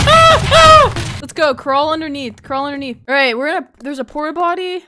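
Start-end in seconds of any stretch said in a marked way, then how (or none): 0.1-1.2 high, louder fright scream. Real, not acted. Player chased by Judy, screaming. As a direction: shriek while being chased.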